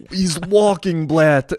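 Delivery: high, loud mocking Ukrainian accent. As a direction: mimic the Ukrainian with a heavy Ukrainian accent. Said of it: "in his Ukrainian accent".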